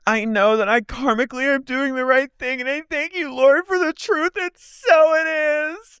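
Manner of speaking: high, slowing pious imitation; mock prayer. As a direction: pray it out like a self-righteous girl. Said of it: "she goes".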